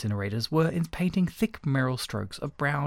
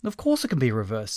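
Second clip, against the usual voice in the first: ordinary delivery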